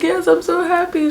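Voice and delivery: high-pitched mock tearful squeal; imitated happy crying. Crying mother-to-be answering an associate, imitated happy crying. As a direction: squeak it out as if weeping with joy. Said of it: "So I'm crying".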